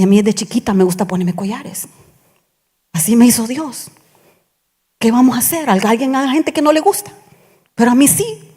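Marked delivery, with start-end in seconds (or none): none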